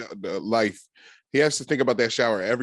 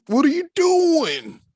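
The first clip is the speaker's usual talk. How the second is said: high, drawn-out mock holler; exaggerated mock exclamation; 4 dB louder, slower than usual. Co-host acting out the "What are you doing" moments, mock exclamation. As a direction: stretch it out high and incredulous. Mimics the commentator's catchphrase.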